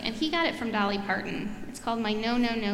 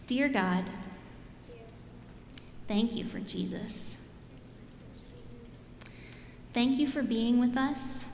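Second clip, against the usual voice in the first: prayer; 5 dB quieter than usual. Real, not acted. Pastor leading children in a repeat-after-me prayer.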